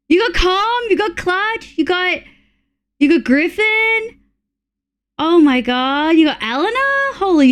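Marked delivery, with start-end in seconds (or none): none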